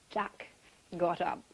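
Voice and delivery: usual speaking voice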